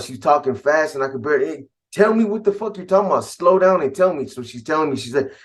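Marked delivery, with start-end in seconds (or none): 1.5-4.3 urgent demand. Retold or imitated. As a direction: push her hard to explain, fast and pitched up. Frantic call with crying girlfriend.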